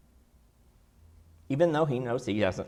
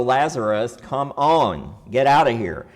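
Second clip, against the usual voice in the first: louder call; 7 dB louder than usual. Shown as a demonstration. Calling into the tomb for a deaf corpse, loud. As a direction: raise it so the dead hear. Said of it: "we have to let him hear".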